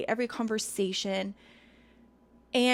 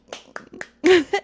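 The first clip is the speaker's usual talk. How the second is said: short high laugh at her own joke; amused closing giggle; light laugh; 9 semitones higher, 9 dB louder than usual. Real, not acted.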